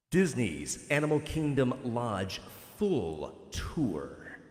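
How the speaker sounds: slow booming announcer echo, slow. Shown as a demonstration. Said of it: "I gotta do this with the Echo voice".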